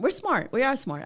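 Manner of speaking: high, bright mock brag; playful claim of being smart; half-joking pride; mock proud boast. Proud mock boast, laughing after.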